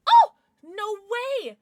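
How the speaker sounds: loud, high exclamation; high burst of astonishment; amazed. Real, not acted. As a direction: cry out in disbelief. Amazed at card pulled.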